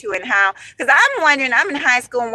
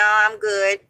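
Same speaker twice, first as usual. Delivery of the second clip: normal talking voice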